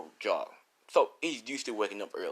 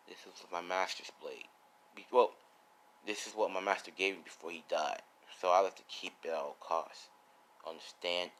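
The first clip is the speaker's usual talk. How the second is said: low, quiet, solemn delivery; serious mode; 4 semitones lower, 6 dB quieter than usual. Acted. Swordsman explaining his master's blade to All Might, serious. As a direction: turn grave and keep it low. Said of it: "As he went serious mode".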